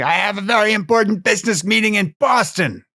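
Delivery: high-pitched, hammed-up frantic businessman; over-the-top film impression; Mister Toomey. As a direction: insist frantically, in a put-on strained high voice. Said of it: "Mister Toomey, who spends the entire series saying".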